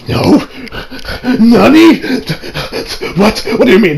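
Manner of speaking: startled anime gasp of a question; shocked Nani. Acted. Confused hero reacts to wrong name, startled. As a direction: sell the disbelief.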